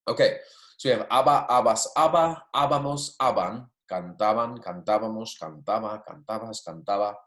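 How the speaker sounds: aba, abas, abamos, aban recited in a chant-like run; drilling endings. Shown as a demonstration. Teacher to class reviewing endings, chanted recital. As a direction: rattle off aba through cantaban. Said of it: "teacher repeats the endings".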